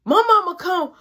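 ordinary talk

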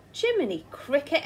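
astonished exclamation. Acted. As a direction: gasp it out at the whale. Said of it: "exclaimed Mister Grinling".

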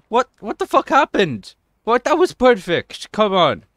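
high, loud indignant protest; outraged disbelief. Real, not acted. Gamer protesting a failed perfect trick, outraged disbelief. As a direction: cry foul at the game, pitch leaping up.

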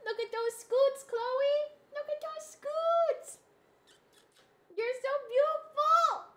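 high cooing pet voice. Real, not acted. Streamer coos at her cat Chloe on stream, high pet baby talk. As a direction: coo at the kitty sweetly.

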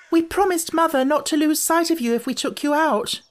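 put-on very high little-girl voice; blurted protest. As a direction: blurt it out in a high, childish pitch.

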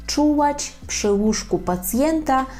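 teacher's slow model phrase; slow, careful repeat. Shown as a demonstration. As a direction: spell out "Czuwać przy łóżku pacjenta" slowly, syllable by syllable. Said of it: "ми скажемо czuwać".

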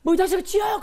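high, loud furious cry; furious crying shout. Retold or imitated. Betrayed boy screaming at his friend, high and angry. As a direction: scream it through tears. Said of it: "펑펑 울면서".

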